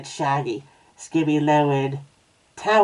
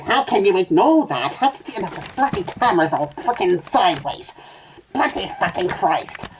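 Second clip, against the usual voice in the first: exasperated outburst, pitched up; exasperated rant; 4 semitones higher than usual. Acted. Reader complains about the crooked camera, exasperated. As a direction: rant as if the equipment is betraying you.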